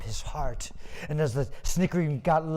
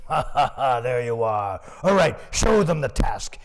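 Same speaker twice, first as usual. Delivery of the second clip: mocking merchant; 3 semitones lower, 4 dB louder than usual. Scornful merchant greets the farmer.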